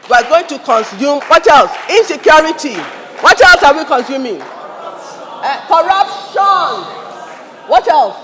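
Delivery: high, loud calls to the crowd; rousing call-out. Real, not acted. Preacher calling on the crowd to name evils, loud and high.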